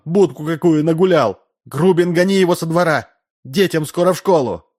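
high-pitched, indignant nagging; indignant housewife's tirade, pitched well up; scolding wife. A narrator's reading. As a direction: tell off a husband in a sustained high voice. Wife scolds her husband in public.

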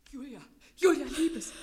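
high, panicked cry; terrified. Acted. Sister crying out to dying Julia in horror.

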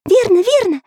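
put-on high eager boy voice; quick nodding high voice; Pencil's voice. A narrator's reading. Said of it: "закивал головой Карандаш".

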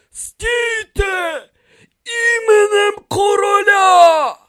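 high, drawn-out loud shout; shouted command. A narrator's reading. Priest orders the fugitives to halt, shouting loud and high. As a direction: cry out an order, stretching the last words. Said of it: "крикнув він".

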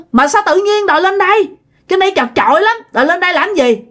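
high-pitched annoyed objection; snapping scolding. Retold or imitated. Dad objecting to the crowded loft, scolding. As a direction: imitate an irritated telling-off. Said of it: "ba mới nạt".

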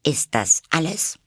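high voice, annoyance held back; hidden annoyance. A narrator's reading. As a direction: ask high, masking your irritation. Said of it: "ihren Ärger so gut sie konnte verbergend". (hoch, mit verhohlenem Ärger)

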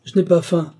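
high, loud huffy refusal; angry refusal; indignant snap. A narrator's reading. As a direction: bark it out, offended. Carquebus furious over his turkey.

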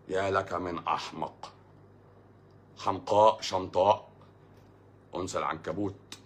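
low, slow, deliberate pronouncement; measured formal scorn with pauses; mock-classical insult. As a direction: intone it like a dubbed drama. Mock classical insults, slowly declaimed.

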